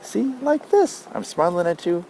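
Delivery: high, slow, sugary sweet demo; beaming Effie. A narrator's reading. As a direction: show her a forced sweet smile in the voice. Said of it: "beams at me".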